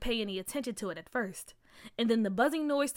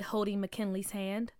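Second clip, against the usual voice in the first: ordinary delivery, nothing marked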